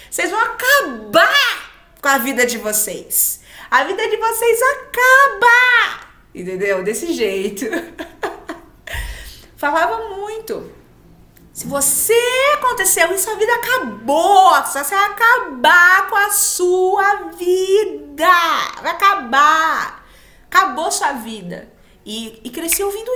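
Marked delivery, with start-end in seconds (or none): 0.1-6.1 high, loud alarmed warning; hammed-up scolding from the relatives; mock family warning. Relatives warning the cousins about early kids, loud mock scolding. 11.5-21.7 mock dire warning. Family saying retold.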